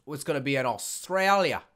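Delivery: loud, hammed-up Australian accent; awful Australian accent. Gamer announcing the event's location, bad Australian accent. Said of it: "That's an awful accent".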